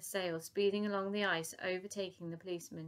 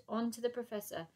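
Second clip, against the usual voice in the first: usual speaking voice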